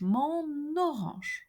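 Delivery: "mon orange" slow, the N pulled onto orange; liaison demo. Shown as a demonstration. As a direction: stretch "mon-n-orange" to show the link. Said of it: "is transferred".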